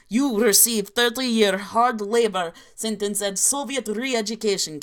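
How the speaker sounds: hammed-up inaccurate Russian accent; a deliberately bad Russian accent, played for laughs. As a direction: read it like a comic Soviet narrator with a bad Russian accent. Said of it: "who's ready for an inaccurate Russian accent".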